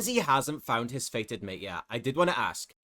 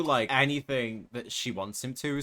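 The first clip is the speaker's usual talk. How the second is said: ordinary talk, nothing marked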